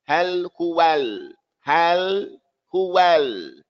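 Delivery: "Hal huwal" drawn out, each l fully sounded; slow, open a in "hal" and "huwal"; clear articulation. Shown as a demonstration. Teacher modelling clear fatha for students, slow "Hal huwal". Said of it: "help your articulation be clear".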